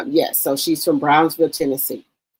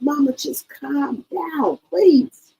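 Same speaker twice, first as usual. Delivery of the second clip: much higher, slow, pleading; higher, drawn-out plea to calm down; pleading with Mama; 8 semitones higher, much slower than usual. Retold or imitated. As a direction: soothe the mother, slow and high. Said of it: "I'm like".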